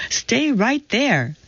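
high, drawn-out command without added volume; mild yell. A narrator's reading. Owner ordering her cat to stay put, mild high yell. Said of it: "pointed at Tibbs and yelled".

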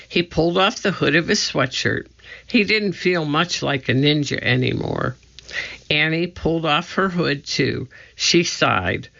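ordinary delivery

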